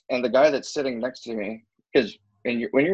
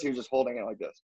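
much softer, "just holding" played down; soft understatement; 9 dB quieter than usual. Shown as a demonstration. Showing friends the gentler grip, softly understated. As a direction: drop the volume on "just holding it".

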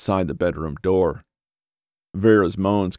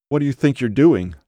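quavering voice; 6 semitones higher, 3 dB louder than usual. A narrator's reading. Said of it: "her voice quavered".

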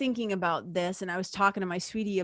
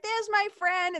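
excited; 12 semitones higher than usual. Retold or imitated. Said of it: "And I was like".

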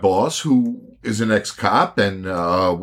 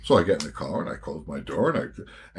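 ordinary talk

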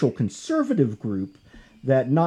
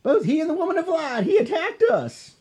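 laughing whiny thugs. Retold or imitated.

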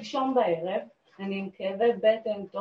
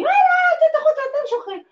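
panicked protest; 6 dB louder than usual. Retold or imitated. Panicked reply to police dispatcher.

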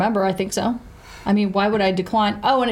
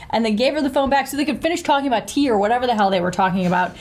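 ordinary delivery

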